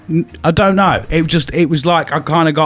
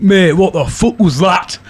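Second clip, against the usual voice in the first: slow, higher Geordie impression of a mate; Geordie accent; 5 semitones higher, slower than usual. Teller voices his mate woken by screaming, slow Geordie accent. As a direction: imitate a startled Geordie, drawn out. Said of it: "he's a, he's a Geordie".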